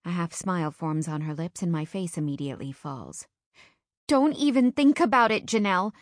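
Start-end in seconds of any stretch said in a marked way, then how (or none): 4.1-5.9 tearful hiccup. Acted. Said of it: "I hiccup".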